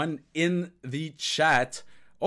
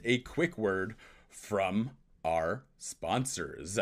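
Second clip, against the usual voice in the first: slow, drawn-out mock announcer tone; 4 semitones lower, 4 dB quieter, much slower than usual. Host tossing to a sponsor break in a slow mock announcer voice. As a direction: stretch it out like a radio announcer tossing to an ad.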